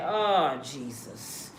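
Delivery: low, hushed fatherly God, sorry for his son; weary God apologizing. Retold or imitated. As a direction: sigh it out like a tired father who made his son suffer. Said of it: "God probably up there ... be like".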